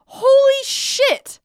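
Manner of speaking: high, loud, stretched-out disbelief; appalled exclamation. Real, not acted. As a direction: draw both words out, aghast. Appalled by the lyrics.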